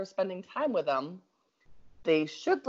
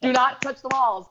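louder, higher stern warning; stern command; 6 semitones higher, 6 dB louder than usual. Retold or imitated. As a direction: lay down the law, loud and firm. House rules to the kids.

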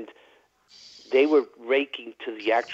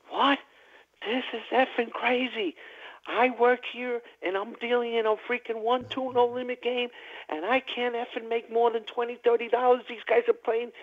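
much higher-pitched mock rant; retold dealer rant; 13 semitones higher than usual. Dealer ranting at the table about his tips, high-pitched mock fury. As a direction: squeal it out like a dealer losing it over tips. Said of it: "this is a rant that this guy went off on".

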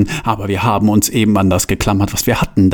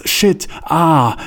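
mild cry, pitched up; high exclamation; 6 semitones higher than usual. A narrator's reading. Bruno curses as Franz sinks, in a mild high cry. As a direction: exclaim it high without real shouting. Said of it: "rief Bruno".

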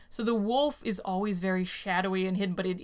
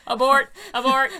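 loud robot warning; 3 semitones higher, 6 dB louder than usual. Pretend. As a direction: call out like an alarmed robot. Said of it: "the voice you're gonna be doing".

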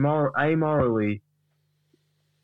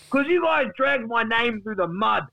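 aggrieved Duck; 6 semitones higher than usual. Acted. Duck accuses the guys of dragging his name, aggrieved tirade. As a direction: wail the accusations, stretching key phrases. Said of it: "this is getting personal".

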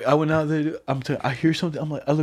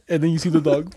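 normal talking voice

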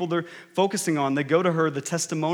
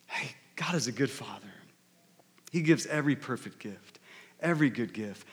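whispered reminder; 5 semitones lower, 8 dB quieter than usual. Acted. Reminding oneself of God's goodness.

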